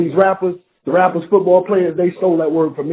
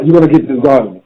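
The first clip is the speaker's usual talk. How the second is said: louder emphatic repeat; 4 semitones lower, 6 dB louder, faster than usual. Shown as a demonstration. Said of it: "every time I speak".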